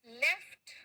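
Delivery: लेफ्ट with short e, crisp ft, rising; past-tense left. Shown as a demonstration. Teacher leading a pronunciation drill, लेफ्ट with clear ft. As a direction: release the final t of लेफ्ट clearly. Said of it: "मेरे साथ में इसका pronounce".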